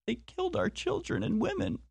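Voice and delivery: high, soft fake sob of grief; mock crying. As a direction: whimper it like crocodile tears, hammed-up. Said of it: "cry crocodile tears and say".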